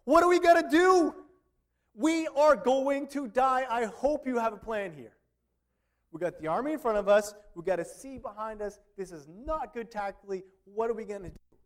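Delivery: high, loud panicky plea, slowing on "We are going to die"; panicked Israelites. Retold or imitated. Israelites pressing Moses about the army, panicked and loud. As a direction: play the frightened crowd begging Moses for a plan. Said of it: "they're coming up to Moses like".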